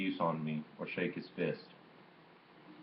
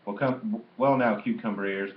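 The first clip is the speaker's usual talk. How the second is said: lively call; 3 semitones higher, 5 dB louder than usual. A narrator's reading. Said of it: "called out to me in a lively voice".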